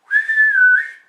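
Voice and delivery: a sudden high squealing cry; mock high squeal. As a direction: squeal up high at the cat to tease it. Imitated sound at cat.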